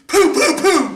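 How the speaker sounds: high, loud "poo" shot noises; punchy mouth-made shots; imitated gunshots. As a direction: mimic a gun going off, loud and high. Imitates uncle firing shots.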